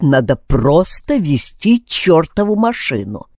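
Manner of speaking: usual speaking voice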